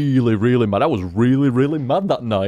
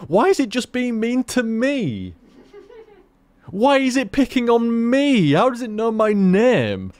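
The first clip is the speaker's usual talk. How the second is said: higher whiny voice, hammed-up; mock toddler tantrum; 9 semitones higher than usual. Mocking toddler gamers as he describes a tantrum, higher whine. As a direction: play a sulky kid blaming the game. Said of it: "tantrum sort of shit".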